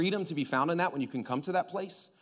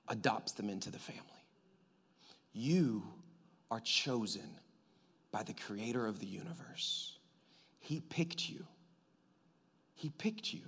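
soft, breathy whisper, much softer; tender whisper; 6 semitones lower, 9 dB quieter than usual. Acted. Preacher telling each listener God chose them, whispered. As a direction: hush it like a secret to each one.

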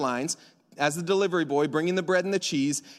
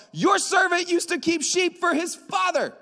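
much higher, put-on voice for David's line; mocking David retort; 10 semitones higher than usual. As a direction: play it as a cheeky kid boasting. Preacher playing David's retort.

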